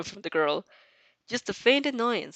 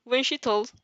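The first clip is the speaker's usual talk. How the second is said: usual speaking voice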